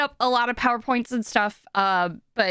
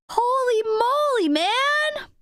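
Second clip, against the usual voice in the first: very high, astonished exclamation; amazed; 11 semitones higher, slower than usual. Real, not acted. Streamer reacting to a viewer's big gift, amazed. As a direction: marvel at it, voice way up high. Stunned by gifted subscriptions.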